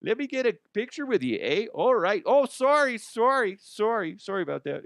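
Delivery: high-pitched, over-polite Canadian caricature; hammed-up Canadian apologizing in a high voice; mock Canadian accent. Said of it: "oh, she's Canadian".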